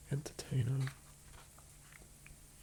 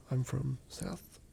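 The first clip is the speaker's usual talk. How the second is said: ordinary talk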